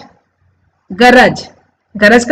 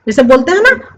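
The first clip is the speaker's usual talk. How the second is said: ordinary delivery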